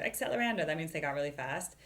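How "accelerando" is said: excited call. Retold or imitated. Excited as band speeds up.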